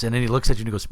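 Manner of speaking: normal talking voice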